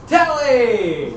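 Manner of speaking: loud, high happy shout of a name; excited greeting. Acted. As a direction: call back to your friend with joy. GM voices Rick greeting back.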